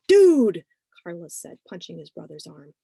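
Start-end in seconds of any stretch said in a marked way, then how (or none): 0.1-0.6 loud outburst. Acted. Carlos interrupts his brother.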